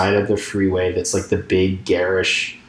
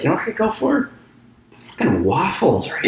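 late night craving voice; 9 semitones higher than usual. Retold or imitated.

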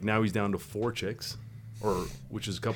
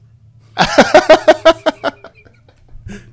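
high, loud imitated laugh; hammed-up laugh; imitated big laugh; 18 dB louder than usual. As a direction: mimic the guy's huge burst of laughter. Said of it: "He looks up and he goes".

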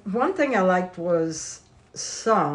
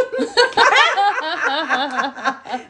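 laugh; 9 semitones higher, 6 dB louder than usual. Real, not acted. Guest laughs to start.

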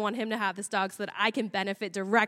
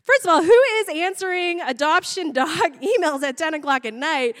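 higher, louder comic disbelief; incredulous; 4 semitones higher, 4 dB louder than usual. Retold or imitated. Stoned woman on clinic answering emails at night, incredulous. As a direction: question it with amused outrage.

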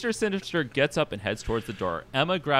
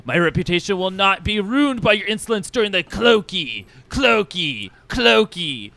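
loud, high, grandiose indignation; haughty ranting boss; 6 semitones higher, 5 dB louder than usual. Acted. Boss berating pathetic employees, pompous theatrical rant. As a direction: scold the underlings with pompous disdain.

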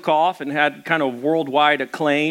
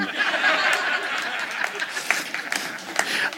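breathy laugh; 10 semitones higher, 6 dB quieter than usual. Real, not acted. Pastor laughs at his own joke.

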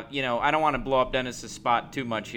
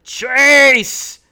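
loud yelled call of a name; shouted name; 12 semitones higher, 14 dB louder than usual. Retold or imitated. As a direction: yell the name across a ballpark. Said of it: "just screams it at him".

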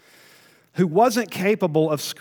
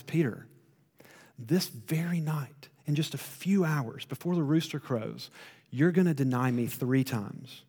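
loving warning; 4 dB quieter than usual. Retold or imitated. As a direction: tell him the hard truth tenderly. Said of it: "he does the most loving thing".

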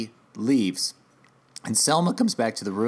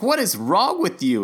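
exasperated; 9 semitones higher, 4 dB louder than usual. Retold or imitated. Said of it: "She's like".

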